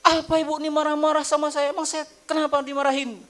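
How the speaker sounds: high, loud offended retort; snapping back. Retold or imitated. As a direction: act the clerk who gets defensive. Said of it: "jangan pula".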